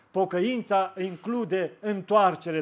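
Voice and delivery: slow and weighty; the preacher stressing the sermon's key line; slow refrain. Acted. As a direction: let each word land.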